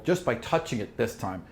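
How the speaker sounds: ordinary delivery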